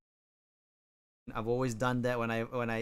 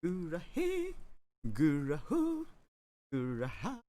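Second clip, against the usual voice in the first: cute voice; 6 semitones higher than usual. Pretend. Playful cute voice variants.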